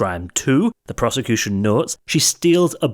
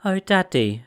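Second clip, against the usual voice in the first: high little bear's whine; baby bear crying out; 9 semitones higher than usual. Acted. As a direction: cry out like a hurt little cub. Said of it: "cried the baby bear".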